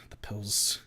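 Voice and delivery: urgent whisper. Acted. Narrator craving the pills in the car, whispering.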